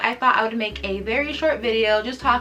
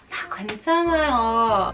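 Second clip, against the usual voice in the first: easygoing "괜찮아요", in an unworried tone; laid back; faster than usual. Shown as a demonstration. Telling someone it's fine, laid back. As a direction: brush it off casually, it's fine. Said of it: "like, laid back".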